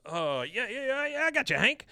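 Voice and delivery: sudden high-pitched yeahs; excited yeah. Retold or imitated. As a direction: jump way up in pitch, eager to agree. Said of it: "He goes".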